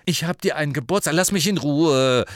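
put-on fast, loud impatient boy; impatient Ron. A narrator's reading. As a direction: brush her off fast. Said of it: "sagte Ron ungeduldig".

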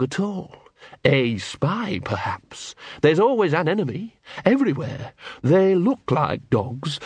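high, slow menacing dog; creepy zealot dog; sinister poodle voice. A narrator's reading. Big Fido the poodle menacing.

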